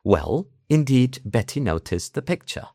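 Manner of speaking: slow, formal RP exam answer; posh southern British. Shown as a demonstration. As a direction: answer like a British textbook recording, crisp Ts. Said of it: "el acento como más británico del sur".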